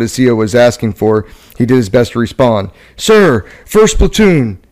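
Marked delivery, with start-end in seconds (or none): 3.0-4.6 raised, urgent radio report; crackly military radio. Acted. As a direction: call it in over the handset. Radio report during firefight.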